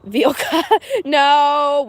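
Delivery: ordinary talk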